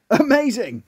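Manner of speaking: high, delighted exclamation; amazed. Acted. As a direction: marvel at magic that worked. Milk amazed by the duck.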